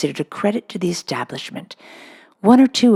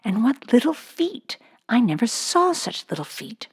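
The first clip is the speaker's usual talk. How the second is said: put-on awed girl; 7 semitones higher than usual. Schoolgirl marvels at new pupil.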